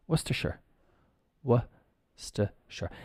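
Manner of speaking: soft "Worcestershire", then slowly broken into wuh-stuh-shur; syllable demo. Shown as a demonstration. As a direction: quietly model "Worcestershire", repeating it syllable by syllable. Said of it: "it becomes Worcestershire".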